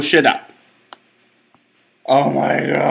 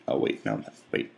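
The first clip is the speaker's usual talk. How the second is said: whispered; 11 dB quieter than usual. Real, not acted. Panicked whisper.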